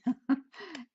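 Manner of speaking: short high laugh; bright laugh. Real, not acted. Laughs at herself.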